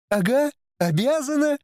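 high mocking retort; insolent scoff. A narrator's reading. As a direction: throw it back cheekily. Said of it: "нагло заколыхалась Сиера".

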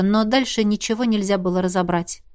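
ordinary delivery, nothing marked

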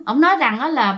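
plain reading voice

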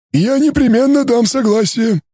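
high, strange alien timbre; a cool, detached alien scholar. Acted. Alien answers coordinator. (высокий голос инопланетянина)

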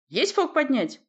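loud, high echoed order; shouted echo. A narrator's reading. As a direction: bellow it back twice as loud. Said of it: "вторил боцман голосом вдвое громче".

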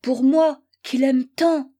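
understated tearful complaint; wounded, with a touch of crying; tearful lament. A narrator's reading. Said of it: "répétait-elle en pleurant".

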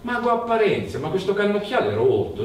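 più acuto, di fretta, delusione infantile; protesta stupita. A narrator's reading. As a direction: lamentati che il cannocchiale è rotto. Zosimo protesta col mago. (Zosimo si lamenta col mago, acuto e concitato)

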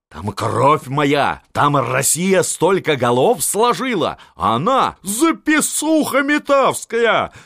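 high loud furious tirade; old marshal railing at the empress; raging. A narrator's reading. Marshal raging about lost land. (яростно, высоким криком)

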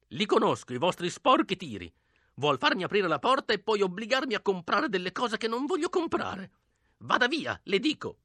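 put-on high, strained old-woman voice; old woman scolding. A narrator's reading. Old woman refuses to open to strangers, scolding.

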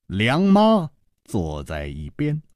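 ordinary delivery

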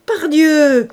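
loud, high exclamation with a laugh behind it; a carter laughing loudly at the offer; guffawing exclamation. A narrator's reading. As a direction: boom it out with a big laugh. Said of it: "s'écria avec un gros rire".

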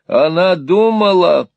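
loud, high, drawn-out exclamation; emphatic cry. A narrator's reading. Newman assures Smike that she thought of him, crying out. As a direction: cry it out slowly with full conviction. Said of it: "крикнул Ньюман".

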